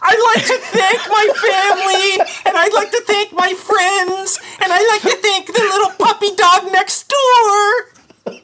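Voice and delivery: high, teary award-speech thanks; mock acceptance speech. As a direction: gush like a tearful winner.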